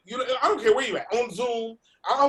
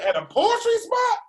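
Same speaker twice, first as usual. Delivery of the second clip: incredulous; 5 dB louder than usual. Retold or imitated. Replaying his own disbelief.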